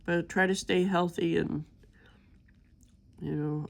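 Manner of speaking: normal talking voice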